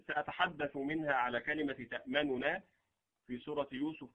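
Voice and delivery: usual speaking voice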